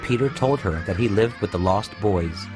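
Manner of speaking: plain reading voice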